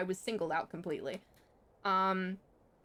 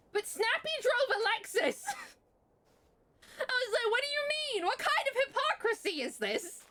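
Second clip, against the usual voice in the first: much higher, incredulous outburst; indignant disbelief. Real, not acted. Telling the viewers about the teacher's Lexus, in high indignant disbelief. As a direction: squeak out the outrage at the hypocrisy.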